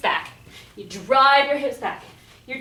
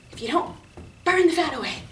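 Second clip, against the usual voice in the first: usual speaking voice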